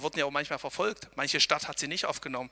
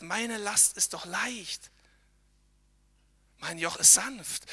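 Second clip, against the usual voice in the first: gentle Jesus voice; 3 semitones higher, slower than usual. Retold or imitated. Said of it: "Er sagt".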